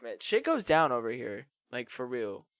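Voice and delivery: ordinary talk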